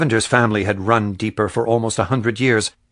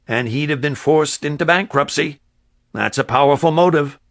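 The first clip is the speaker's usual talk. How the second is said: earnest deputy; 5 semitones higher, 3 dB louder than usual. Acted. Raskovich presses the motive.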